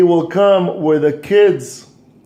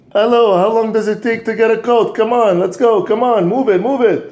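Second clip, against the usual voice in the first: higher, louder, hurried nagging; impatient father; 7 semitones higher, 3 dB louder than usual. Acted. Busy father calling his son in the attic, impatient. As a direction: rush him, you're out of patience.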